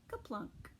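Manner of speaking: ordinary delivery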